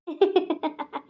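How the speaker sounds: a quick playful laugh; teasing laugh. Acted. Girl laughs after teasing her brother's friend. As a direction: laugh at your own cheeky joke.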